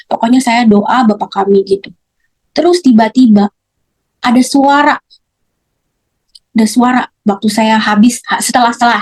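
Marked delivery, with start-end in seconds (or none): none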